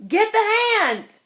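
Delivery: high, loud, urgent yell; shout. A narrator's reading. Farmer yelling to his farmhands at the pond, loud shout. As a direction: call the farmhands in a panic. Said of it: "the farmer shouted".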